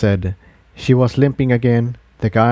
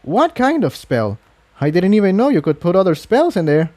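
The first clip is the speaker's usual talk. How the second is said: curious; 8 semitones higher than usual. A narrator's reading. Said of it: "incredulity shifted to curiosity".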